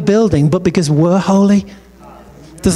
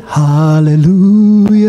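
mock faith-healer; 4 dB louder, much slower than usual.

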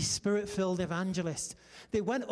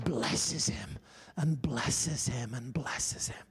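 hushed whisper; 9 semitones lower, much slower than usual. Acted. Preacher retelling the old man's blessing to the congregation, slow fading whisper. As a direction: breathe it out reverently, slowing down.

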